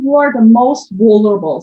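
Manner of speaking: normal talking voice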